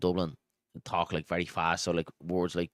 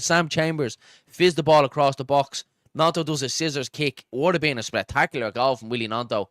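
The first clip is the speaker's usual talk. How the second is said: loud, raised-pitch play recap after a near miss; excited commentary; 6 semitones higher, 12 dB louder than usual. Real, not acted. Commentator recapping a scissors kick saved by the goalkeeper, excited. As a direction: start on a big high "oh", then ease down gradually. Commentator reacts to big chance.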